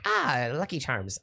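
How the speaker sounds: slow, drawn-out mock Irish; hammed-up cereal-mascot Irish; cartoon Irish accent. Shown as a demonstration. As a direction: try a corny Irish brogue. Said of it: "How's my Irish accent?".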